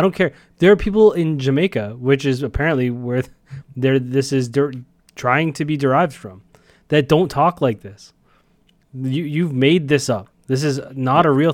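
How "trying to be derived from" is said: usual speaking voice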